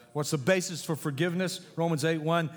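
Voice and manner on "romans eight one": ordinary talk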